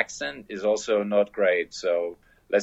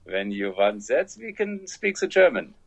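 exaggerated German accent; 3 semitones higher than usual. Shown as a demonstration. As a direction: ham up a thick German accent. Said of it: "What's my f-, best German accent?".

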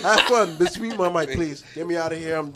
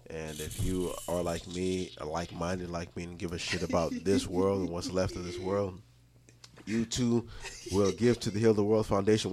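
mock charity appeal; 11 semitones lower, 8 dB quieter than usual. Giving his final thoughts as a solemn charity pitch. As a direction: lay it on like a charity ad.

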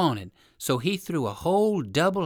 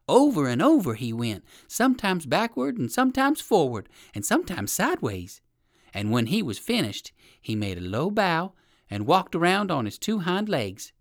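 plain reading voice

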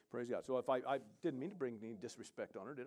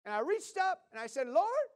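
put-on much higher, louder voice of the woman in her accent; imitating the woman; 8 dB louder than usual. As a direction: lift into her high, earnest voice. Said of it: "So she said".

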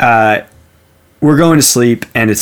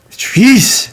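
sudden high, loud spooky cry; fake sleep talk; 13 semitones higher, 5 dB louder than usual. Faking sleep talk after long silence, eerie high outburst. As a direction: burst out high, as if dreaming. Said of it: "what I was trying to fake".

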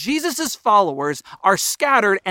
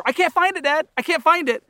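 put-on much higher, whining child's voice; whiny kid; 7 semitones higher than usual. Kid calling to dad from the fridge, high and whiny. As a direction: whine like a kid who can't find it. I've told my kids.

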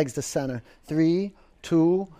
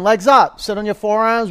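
higher, louder call to the class; loud coach call; 6 semitones higher, 7 dB louder than usual. Real, not acted. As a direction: call it out to the room. Coach calling the next move.

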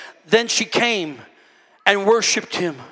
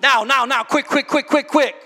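rapid-fire repeated words, higher and urgent; impatient demand; 7 semitones higher, 5 dB louder than usual. Acted. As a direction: act out hurrying someone along. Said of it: "it's gotta be quick".

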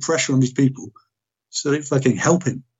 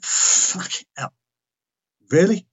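retold stunned disbelief; 7 semitones higher, slower than usual. Retold shock at being told to section.

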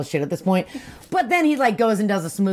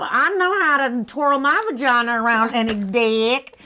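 high, slow, hammed-up Cajun drawl; mocking impression in a terrible Cajun accent; horrible Cajun accent; 5 semitones higher than usual. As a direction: do a badly faked Cajun girl. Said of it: "like, really horrible accent".

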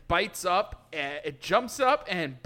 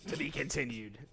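low, quiet trailer voice; announcer voice; 6 semitones lower, 6 dB quieter than usual. Pretend. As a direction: close the episode like a narrator. Mock ending announcement.

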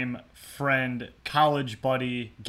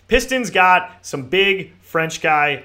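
excited; 6 semitones higher, 11 dB louder, much slower than usual. Real, not acted. Excited Pistons news.

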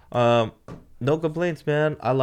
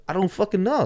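high-pitched clueless protest; helpless exasperation; 12 semitones higher, 3 dB louder than usual. Retold or imitated. Driver and Ed unsure what to do, helplessly exasperated. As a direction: throw it up high, at a loss. Acts out crew's helpless answer.

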